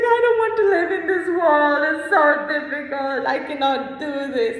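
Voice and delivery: high, loud, despairing wail; retold crying despair. Recalling crying over paperwork, a high loud despairing wail. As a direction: replay the moment of breaking down in tears. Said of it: "One time I started crying".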